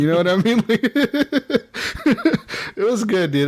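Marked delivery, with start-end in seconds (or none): 0.7-2.7 high laugh. Real, not acted. Laughing at the story.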